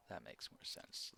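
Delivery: soft unvoiced whisper. Real, not acted. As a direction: breathe it quietly. Repeated line, whispered.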